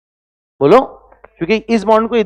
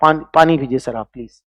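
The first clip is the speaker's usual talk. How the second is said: mock respectful welcome; 6 semitones lower, 4 dB quieter than usual. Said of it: "थोड़ी बहुत इज्जत आपको दूंगा".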